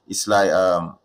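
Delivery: normal talking voice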